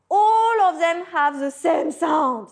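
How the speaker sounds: usual speaking voice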